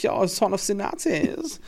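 high, camp mock German accent; hammed-up German accent, lilting high; comic Nazi voice. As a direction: do a silly German villain, drawn out. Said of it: "if you do a Nazi voice".

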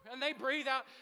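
normal talking voice

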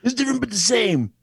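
high, gruff wrestler voice; wrestling promo voice. Retold or imitated. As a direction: parrot the catchphrase like a wrestler. Friend echoes catchphrase.

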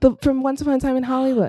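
said fast. Real, not acted. Reminding co-host of the film, said fast. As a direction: rattle the title off in a rush. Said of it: "you said it so fast".

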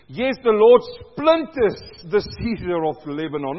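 loud, measured psalm reading; booming scripture proclamation; proclaimed scripture. Acted. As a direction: declaim it to the congregation.

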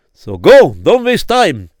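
loud, insistent push; urgent command. Acted. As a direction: send him off urgently. Rabbi urging firmly.

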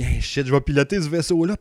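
an eager exclamation to yourself, retold; excited pilot-to-be, timbre lifted out of normal talk; super excited. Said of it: "j'étais super excité".

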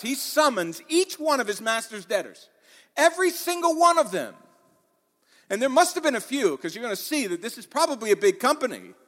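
emphatic pulpit delivery; full-force preaching. Real, not acted. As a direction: preach it loud and high to the whole room.

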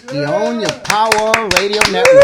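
big booming shout of the station name; announcer shout. Real, not acted. Cohost hyping the network name to listeners, loud announcer shout. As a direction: belt it out like a radio ident.

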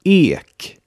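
Ek with a long, closed E as in Beet. Shown as a demonstration. Teacher models Ek for learners to repeat, long closed E. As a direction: say Ek in Swedish, stretching the closed E. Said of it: "das lange geschlossene E".

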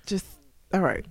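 normal talking voice

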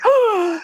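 high, drawn-in mock gasp; acted gasp of disbelief; shocked gasp. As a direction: gasp as if stunned by rejection. Acts out shock at rejection.

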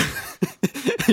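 high burst of laughter. Real, not acted. Laughs at the pun.

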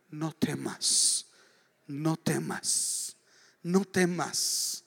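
very slow, each "no temas" drawn out; slow emphatic repetition. Shown as a demonstration. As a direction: lean on the "no" of "no temas" and stretch every word. Preacher repeats the phrase slowly.

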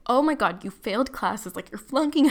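loud, panicky mock outburst; imitated freak-out. Mom fearing her kid failed classes, mock freaking out. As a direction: burst out like an alarmed mother. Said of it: "she starts freaking out".

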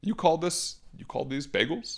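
co-host does a jokey New York bagel gripe; mock New York complaint.

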